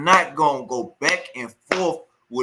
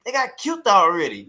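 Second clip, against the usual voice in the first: usual speaking voice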